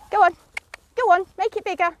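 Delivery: loud, high command to the horse; calling out. Real, not acted. Trainer pushing the mare wider, loud high command. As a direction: drive the horse out onto a bigger circle.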